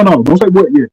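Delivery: normal talking voice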